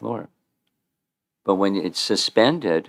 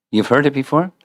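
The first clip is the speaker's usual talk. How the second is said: higher, rising question; lifted voice, checking with the listener; raised repeat question; 6 semitones higher, 4 dB louder, faster than usual. Real, not acted. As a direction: ask it again, pitched up and projected. Repeats the question more insistently.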